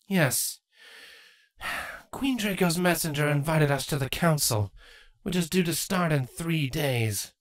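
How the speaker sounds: lightly voiced queen, strained smile; Queen Chrysalis. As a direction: greet them, hiding anger.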